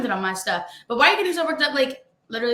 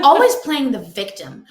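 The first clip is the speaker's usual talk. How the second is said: louder, slow mocking read-out; mock-dramatic; 7 dB louder than usual. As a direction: read the comment with drawn-out scorn. Restarts the comment with dramatic emphasis.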